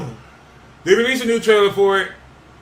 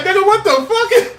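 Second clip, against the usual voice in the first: higher-pitched, laughing mock outrage; mock indignant mosquito; 9 semitones higher than usual. Comic acting out mosquitoes getting mad, mock indignant. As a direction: act out a baffled bug, high and half laughing.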